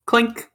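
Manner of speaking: high bright clink of glasses; mouthed glass clink; clink sound effect. Acted. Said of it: "hold up my glass for, like, a clink".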